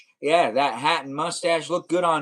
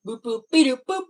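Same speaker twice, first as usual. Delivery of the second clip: robot boops; 9 semitones higher, 4 dB louder than usual. Shown as a demonstration. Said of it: "does have me now doing that".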